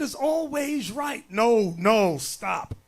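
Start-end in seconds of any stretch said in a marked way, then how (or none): none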